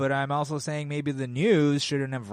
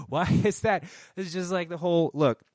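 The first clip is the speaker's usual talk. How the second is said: usual speaking voice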